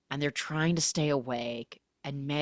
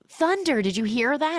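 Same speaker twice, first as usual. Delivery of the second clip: startled; 10 semitones higher, 4 dB louder than usual. Retold or imitated. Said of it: "Lizzie's like".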